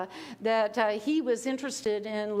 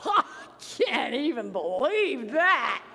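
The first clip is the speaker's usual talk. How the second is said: shocked gasp, slow disbelief; 2 semitones higher than usual. Shown as a demonstration. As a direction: stare at the bathroom scale. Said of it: "laughter of disbelief".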